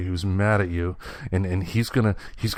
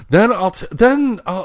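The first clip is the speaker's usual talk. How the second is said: imitating the boy, much higher and coy; coy high squeak; 10 semitones higher, 4 dB louder than usual. Dad voicing his son's promise to girls, squeaky high.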